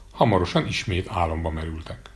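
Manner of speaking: ordinary delivery, nothing marked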